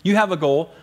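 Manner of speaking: ordinary talk, nothing marked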